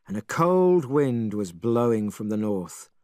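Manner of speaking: usual speaking voice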